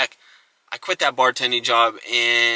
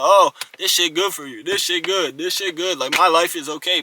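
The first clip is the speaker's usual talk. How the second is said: lightly mocking, higher-pitched past self; a touch of singsong denial, pitched up; mimicked self-delusion; 5 semitones higher, 3 dB louder than usual. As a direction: imitate yourself insisting all is fine. Mimics past self fooling himself.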